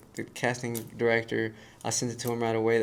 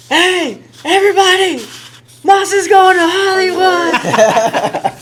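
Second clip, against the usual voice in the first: much higher, much louder, slow, laughing shout to the whole crew; excited shout; 15 dB louder, much slower than usual. Retold or imitated. Friend Wes announcing the Hollywood call to the crew, overjoyed shouting. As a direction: yell the big news out to everyone, beaming. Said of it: "he's super red in the face, and he's super happy".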